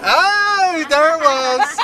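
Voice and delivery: high, loud, drawn-out oh; excited outburst. Real, not acted. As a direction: burst out in loud recognition. Bursting into the remembered name and song.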